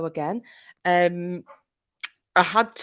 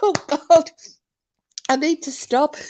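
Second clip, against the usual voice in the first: breathless from laughing; catching breath after giggles; after laughing hard; 7 semitones higher than usual. Real, not acted. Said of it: "I was laughing that hard".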